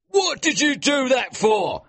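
high, loud angry yell; angry shout. A narrator's reading. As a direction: shout it, furious at a soaked shirt. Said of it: "he shouted at Wild".

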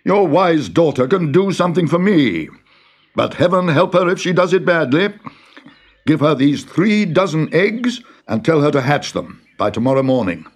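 commanding Tsar. Acted. The Tsar orders the farmer to hatch the eggs, imperious. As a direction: order it like a spiteful monarch.

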